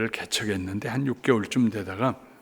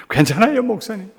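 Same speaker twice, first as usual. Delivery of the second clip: high, tearful reassurance; smiling through tears; 7 semitones higher, 4 dB louder than usual. Retold or imitated. Wife answering pastor's question, smiling through tears. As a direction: insist you are fine while crying. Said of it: "눈물을 막 시냇물처럼 흘러내려요".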